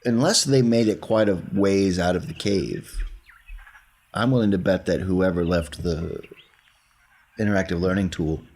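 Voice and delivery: ordinary delivery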